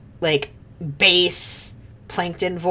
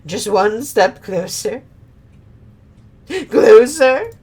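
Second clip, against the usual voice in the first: slow, then a higher louder call; sly coaxing villain; 4 semitones higher, 5 dB louder, slower than usual. Acted. Villain luring the warrior toward the pit, slow and sly. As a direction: draw him in step by step.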